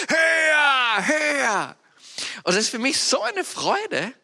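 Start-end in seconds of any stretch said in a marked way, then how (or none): 0.1-1.8 high, loud cheering; cheering call. Retold or imitated. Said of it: "alle rufen wieder".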